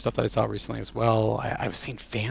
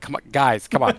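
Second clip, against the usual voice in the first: higher, louder pleading gripe; exasperated protest; 4 semitones higher, 7 dB louder than usual. Retold or imitated. Player to the game's stealth missions, exasperated protest. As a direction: reenact begging the game to give it a break.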